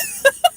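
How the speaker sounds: sudden high, loud laugh; cracking up at her own impression; burst of laughter. Real, not acted. Laughing at her own mock party voice, loud burst.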